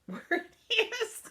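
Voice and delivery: real laughter breaking out loud and high; laughing. Real, not acted. Grandmother laughing at losing her own address. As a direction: laugh through it, giving up on finding it.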